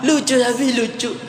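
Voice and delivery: put-on high child's voice, giggling; childlike giggly voice. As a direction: play the little kid laughing at nothing. Imitating a little kid laughing. (suara anak kecil tertawa)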